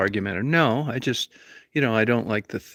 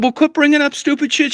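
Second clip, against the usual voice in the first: much higher and louder, furiously ranting; angry yelling; 4 dB louder than usual. Retold or imitated. Reliving a fight with his wife over lottery tickets, yelling angrily. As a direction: shout him down in a heated argument. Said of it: "you don't need to talk to me in that tone of voice".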